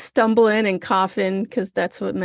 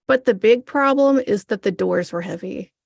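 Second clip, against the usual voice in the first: normal talking voice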